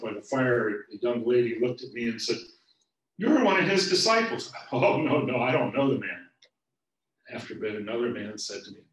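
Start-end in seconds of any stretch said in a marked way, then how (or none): 3.2-4.5 put-on accusing girl. Young lady accuses Peter. 4.7-6.3 high, loud flustered denial. Acted. Peter to the young lady by the fire, loud panicked denial. Peter denies knowing Jesus.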